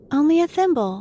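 plain reading voice

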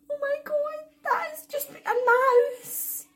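very high, drawn-out whine; horrified wail. Retold or imitated. Reliving the rat being in her house, horrified high wail. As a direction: wail it slowly, close to tears.

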